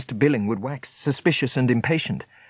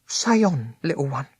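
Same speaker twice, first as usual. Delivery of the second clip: higher, gently urging; Meg urging; 5 semitones higher than usual. A narrator's reading. Meg urges little Smith to go on. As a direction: coax him to speak.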